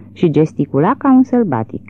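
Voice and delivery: ordinary delivery, nothing marked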